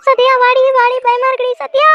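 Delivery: high, loud frightened call for Sathya; panicked calling from upstairs; scared yelling. Acted. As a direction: cry out for your friend in fear. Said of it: "ஏன் இப்படி கத்துறான்னு".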